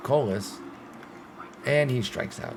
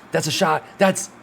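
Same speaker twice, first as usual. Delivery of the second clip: higher and louder, cheering the tying hit; excited call; 6 semitones higher, 5 dB louder than usual. Real, not acted. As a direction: shout it as the game gets tied.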